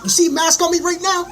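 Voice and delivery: defiant shout at mask wearers; yelled challenge. Real, not acted. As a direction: yell it at the whole street.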